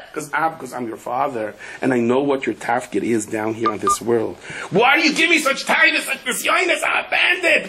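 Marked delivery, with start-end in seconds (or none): 4.7-7.7 high, strained cry to heaven; anguished outcry. Retold or imitated. Man protesting to God, high anguished shout. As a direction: plead upward as if wronged.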